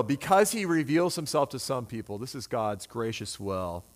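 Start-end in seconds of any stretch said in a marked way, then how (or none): 2.2-3.8 lower, softer and slow, "God's gracious will" savored; soft repetition. Acted. Preacher repeating to the congregation, soft and slow. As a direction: let it sink in gently.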